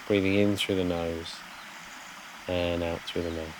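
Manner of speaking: soft, unhurried meditation voice with long pauses; guided meditation. Real, not acted. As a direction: gently guide listeners to settle, phrase by phrase.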